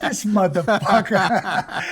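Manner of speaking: slow, laughing through the words; drawn-out laugh-soaked aside; laughing disbelief. Real, not acted. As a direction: crack up in disbelief. Reacting to the bigot's remark.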